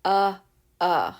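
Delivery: repeated short "uh"; short u sound. Shown as a demonstration. Teacher to kids, demoing short U. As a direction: grunt "uh" short. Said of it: "the short U sound says".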